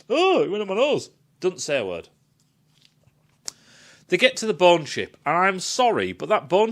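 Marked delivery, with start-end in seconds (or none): 0.1-1.1 higher, quick, dopey mock voice; mock clueless crewman. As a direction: send up the oblivious guy. Mocking oblivious crew member.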